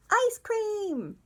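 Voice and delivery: high-pitched mock kid voice; child insisting. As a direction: pipe it up like a stubborn little kid. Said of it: "And they might say".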